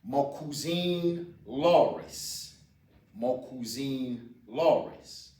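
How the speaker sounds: "Ma cousine Loris" said slowly and clearly; slow, careful model phrase; slow teaching demo. Shown as a demonstration. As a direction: spell it out slowly for learners. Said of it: "the female cousine".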